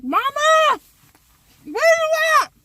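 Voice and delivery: loud high call for mom across the house. Retold or imitated. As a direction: yell for your mother from the door. Calling out for mom.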